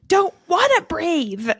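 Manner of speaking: high-pitched mock toddler yell; imitated yell. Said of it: "And she yells at me".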